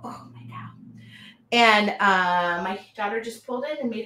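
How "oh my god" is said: voiceless whispered oh my God; exasperated whisper. Retold or imitated. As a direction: whisper it in mock despair at the yelling. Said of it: "I go".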